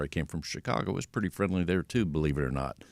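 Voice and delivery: normal talking voice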